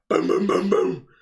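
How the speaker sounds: wife pounding on the bathroom door, loud; mock pounding. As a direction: bang on the door in sound.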